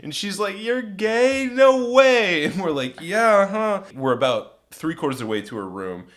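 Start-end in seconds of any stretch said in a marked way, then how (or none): 0.6-2.5 high, loud, incredulous whine; imitating a stunned drunk woman; mock disbelief. 3.0-3.8 playful insistence. Retold or imitated. Men insist on their story.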